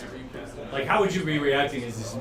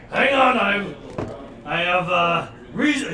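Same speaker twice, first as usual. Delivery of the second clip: yelling out; 3 semitones higher, 4 dB louder than usual. Acted. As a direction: shout to halt the attack. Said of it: "he, he yells out".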